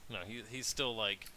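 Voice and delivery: ordinary talk, nothing marked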